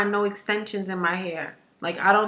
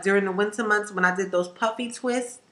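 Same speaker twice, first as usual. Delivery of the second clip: usual speaking voice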